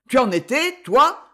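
high, loud angry demand; fierce, rising question; roared accusation. A narrator's reading. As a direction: fling the accusation at the captive. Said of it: "rugit-il".

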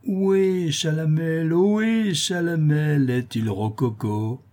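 high, drawn-out heckling calls; mocking jeer. Clerks heckle Chalamel for being naive, high mocking calls. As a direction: hoot the name high, then drop into slow scorn.

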